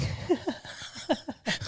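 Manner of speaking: airy unvoiced giggle; breathy laugh. Real, not acted. Laughing at own shoplifting confession.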